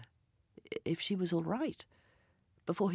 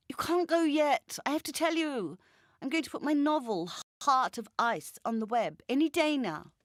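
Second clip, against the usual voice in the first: higher, louder insistent woman's voice; Jacinta pleading; 8 semitones higher, 5 dB louder than usual. Acted. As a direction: hold her back, talking over the phone. Jacinta holding Ray at door.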